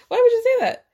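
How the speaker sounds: high-pitched, exasperated protest; high burst of disbelief; appalled disbelief. Real, not acted. Outraged reaction to a retold insult.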